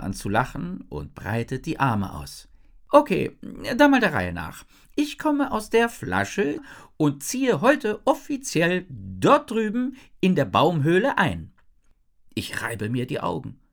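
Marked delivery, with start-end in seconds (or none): 2.9-11.5 high, loud, lively character voice; chirpy genie girl, cheerful. A narrator's reading. Jin laughs and explains where she comes from, cheerful. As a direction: bubble along like an eager young genie.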